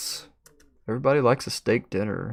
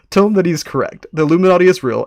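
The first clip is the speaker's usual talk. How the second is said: louder, higher, weight on is; bold proclamation; 6 semitones higher, 8 dB louder, faster than usual. Acted. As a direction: proclaim it grandly. Proclaiming the Illuminati.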